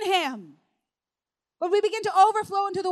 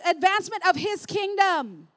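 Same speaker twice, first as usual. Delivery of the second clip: normal talking voice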